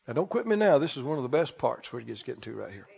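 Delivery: usual speaking voice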